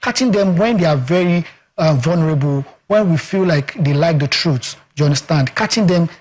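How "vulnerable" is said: normal talking voice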